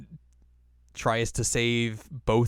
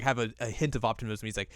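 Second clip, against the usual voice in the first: ordinary talk, nothing marked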